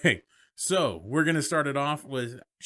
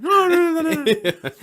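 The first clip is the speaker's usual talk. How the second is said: mock frantic protest; 8 dB louder than usual. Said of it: "Everybody's like".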